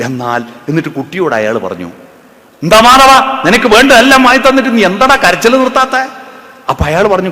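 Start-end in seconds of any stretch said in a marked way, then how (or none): 2.6-6.0 angry scolding. Retold or imitated. Annoyed bystander scolds crying child.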